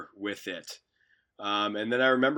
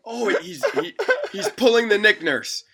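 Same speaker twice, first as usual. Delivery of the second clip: much higher, louder, laughing through a hammed-up French Canadian accent; mock French Canadian accent; 12 semitones higher, 8 dB louder than usual. Rival coach scoffing at a defence, laughing French Canadian accent. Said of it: "That's my French Canadian accent".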